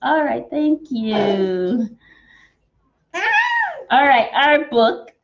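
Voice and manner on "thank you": ordinary delivery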